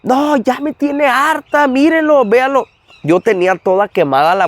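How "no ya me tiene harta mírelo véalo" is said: high, slow, exasperated mother. Retold or imitated. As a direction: plead like a worn-out mom. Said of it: "Que le dice mi mamá". (madre harta y exasperada)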